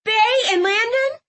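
put-on high, hopeful girl's question. A narrator's reading. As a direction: ask it with hope lifting the voice. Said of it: "Clove sounded hopeful".

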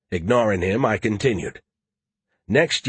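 plain reading voice

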